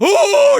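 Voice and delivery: very high, loud "ooh"; imitated ghostly howl; banshee wail. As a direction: shriek it like a banshee in someone's face. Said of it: "and it's like".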